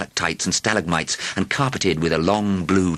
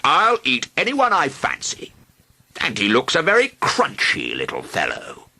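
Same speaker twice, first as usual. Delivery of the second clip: a lightly voiced greedy dragon, amused; 8 semitones higher than usual. A narrator's reading. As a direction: savour the word like a hungry dragon.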